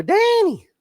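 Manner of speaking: name called out in a mock squeal; high-pitched voice. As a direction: mimic a buddy's high, drawn-out call. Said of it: "he's got that high-pitched voice".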